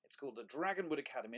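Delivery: plain reading voice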